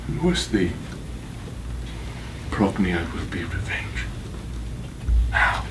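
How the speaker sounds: low, hushed vow of revenge; quiet menace. Acted. Avenger readies the killing, low and hushed. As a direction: drop right down after the outburst.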